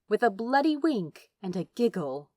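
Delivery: ordinary delivery, nothing marked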